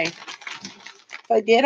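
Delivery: ordinary talk, nothing marked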